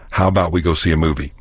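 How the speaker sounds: "about" said as "'bout", quick; dropped A. Shown as a demonstration. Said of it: "dispense with the A in about".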